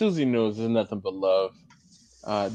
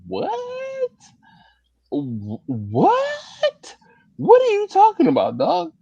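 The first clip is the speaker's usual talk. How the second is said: high, loud disbelief on what are you talking about; incredulous what; 4 dB louder, slower than usual. Retold or imitated. Host retelling his reaction to a comment, high and loud disbelief. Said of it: "I was like".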